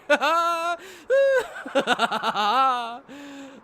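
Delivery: high, loud gloating cackle; a villain pleased his secret was spotted, laughing high and loud; villainous laugh. A narrator's reading. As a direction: cackle with cruel delight.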